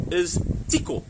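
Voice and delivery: "tiko" diucapkan jelas dengan bunyi I. Shown as a demonstration. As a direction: say "tiko" with a clear I, tee-ko. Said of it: "if you say that back to me".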